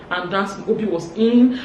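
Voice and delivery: ordinary delivery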